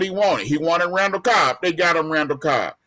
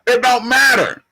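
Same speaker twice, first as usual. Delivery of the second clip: loud, raised-pitch insistence; emphatic dismissal; 5 semitones higher, 7 dB louder than usual. Real, not acted. Co-host rebuts a reminder about Green Bay, loud emphatic dismissal. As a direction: hammer it home, louder and higher. Heated argument, cutting off a rebuttal.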